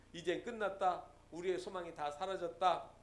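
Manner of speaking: soft, sunken lament of giving up; mock despair. Israelites weeping after the spies' report, in soft despair. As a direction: sigh it out as hopeless Israelites. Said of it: "이스라엘 백성들이 울으면서 한 말이".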